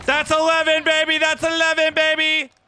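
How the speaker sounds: high, sustained excited shout; real high-pitched hype yell; excited celebration. Real, not acted. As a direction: crow it out in triumph. Celebrating in the game.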